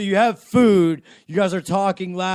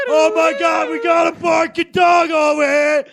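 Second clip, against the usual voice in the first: much higher, louder hammed-up alarm; mock panicked cop; 13 semitones higher, 6 dB louder than usual. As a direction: shriek it like a frantic dispatcher. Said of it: "they're gonna fucking send the squad cars".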